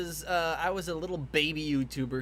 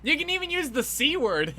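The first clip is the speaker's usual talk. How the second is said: excited; 10 semitones higher, 7 dB louder than usual. Real, not acted. Teasing chat about swearing.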